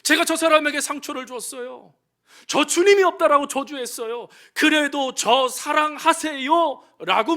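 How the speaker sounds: loud, high pleading sermon voice; fervent preaching. Acted. Preacher voicing Peter, loud and pleading.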